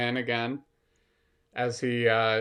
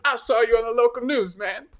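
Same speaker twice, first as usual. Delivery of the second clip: imitating a buddy; 9 semitones higher, 5 dB louder than usual. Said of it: "and he goes".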